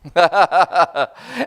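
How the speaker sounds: bright delighted laugh; real laugh. Real, not acted. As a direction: laugh out loud with joy. Pastor laughs at own story.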